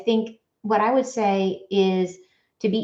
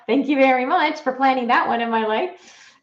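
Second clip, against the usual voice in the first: laughing thanks; 5 semitones higher, 3 dB louder than usual. Real, not acted.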